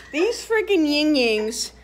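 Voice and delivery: loud, high, drawn-out exasperation; outraged shout. Real, not acted. Telling on her friends' shirts, outraged shout. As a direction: build into an outraged yell.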